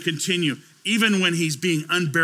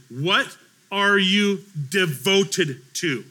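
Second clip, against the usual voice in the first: very slow, deliberate questioning; slow, weighty question; 2 semitones higher, much slower than usual. Real, not acted. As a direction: put the question word by word. Poses question to congregation slowly.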